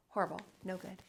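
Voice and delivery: ordinary delivery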